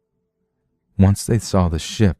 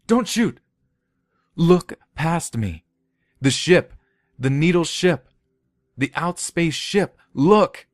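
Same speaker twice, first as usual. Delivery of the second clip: high, desperate cries; high frantic plea; frantic pleading; 11 semitones higher than usual. Acted. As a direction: beg them to look, panicked. Man begging guards not to fire.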